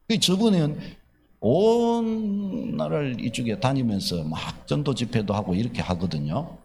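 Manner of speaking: normal talking voice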